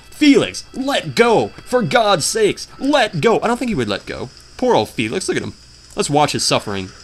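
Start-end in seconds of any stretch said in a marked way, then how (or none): 0.1-3.4 mock scream. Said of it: "even if I screamed at him".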